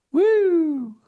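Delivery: high hammed-up whoop; a sudden high, sarcastic cheer; mock cheer. Speaker mocks the fans cheering their football team, hammed-up whoop.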